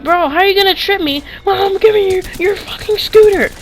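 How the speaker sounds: put-on furious high-pitched yell at the kid; heated yelling. To the kid who tripped him, a furious shout. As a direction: cuss him out at full volume. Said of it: "I got so heated".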